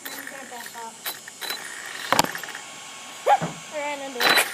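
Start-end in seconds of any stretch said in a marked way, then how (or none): 1.0-3.7 high airy giggling; breathless giggle. Real, not acted. After ramming the iPad.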